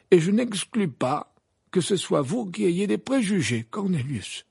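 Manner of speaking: high, composed but firm voice; peaceful Dumbledore. A narrator's reading. Dumbledore turning the accusation on Cornelius, composed. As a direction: turn the charge back evenly. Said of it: "du même ton paisible".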